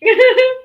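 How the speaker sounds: high squealing laugh. Real, not acted. Laughing after a joke.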